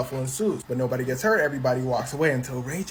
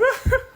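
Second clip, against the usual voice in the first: shrieking high loud laugh; 8 dB louder than usual. Retold or imitated. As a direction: burst into a squealing laugh.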